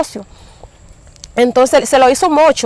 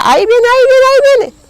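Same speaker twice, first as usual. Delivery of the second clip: much higher, louder panicked cry; retold terrified cry; 12 semitones higher, 4 dB louder than usual. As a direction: cry out in terror that something is coming. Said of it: "Y yo pegaba gritos y, y decía". (grito de terror, mucho más agudo y fuerte)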